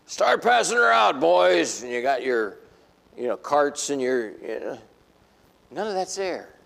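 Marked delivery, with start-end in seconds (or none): 0.0-1.8 higher and louder, calling to the crew; cheerful boss shout; calling out orders. Pretend. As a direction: holler it out to the boys.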